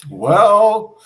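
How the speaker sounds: emphatic well. Retold or imitated. Telling the man off with a laughing, loud well. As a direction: burst out with it, amused. His retold answer, laughing.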